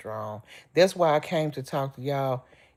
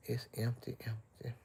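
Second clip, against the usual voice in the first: softer and softer, trailing away in grief; sad fading repeat; 5 semitones lower, 18 dB quieter than usual. Real, not acted. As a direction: let the word die out, heartbroken. Grieving daughter's empty room.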